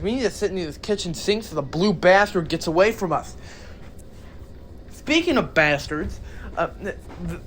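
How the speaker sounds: ordinary talk, nothing marked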